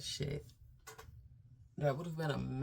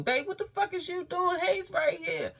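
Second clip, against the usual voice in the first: far higher, louder shriek imitating the sister; frantic imitated shriek from the hallway; 5 dB louder than usual. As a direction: screech it like she's panicking outside the door. Sister knocking at the door.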